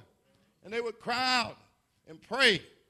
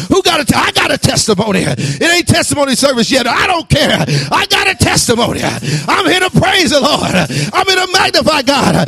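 loud, high, bursting to testify; eager testimony; 7 semitones higher, 13 dB louder than usual. Shown as a demonstration. Preacher demonstrating old-time testimony, loud and eager. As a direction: jump up to share your testimony. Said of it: "don't make me demonstrate".

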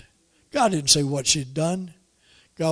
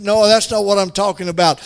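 ordinary talk

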